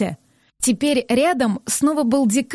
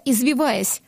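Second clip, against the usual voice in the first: plain reading voice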